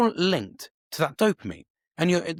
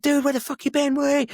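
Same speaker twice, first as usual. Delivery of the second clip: high, louder burst of mock welcome; excited greeting; 10 semitones higher, 4 dB louder than usual. Greeting someone who re-engages with him, excited. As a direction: greet a long-lost friend with eager high pitch. Greeting a long-lost friend.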